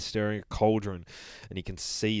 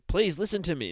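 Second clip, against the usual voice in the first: high pleading voice; 8 semitones higher, 4 dB louder than usual. Acted. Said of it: "and he's like".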